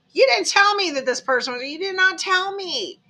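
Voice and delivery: high, mock-outraged accusation; indignant reproach. Acted reproach to the parent.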